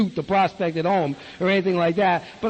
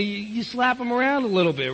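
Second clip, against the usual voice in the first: normal talking voice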